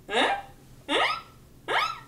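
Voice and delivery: very high, short questioning grunts; mock suggestive prompting. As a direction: nudge with squeaky little huhs. Acts out man's suggestive prompting.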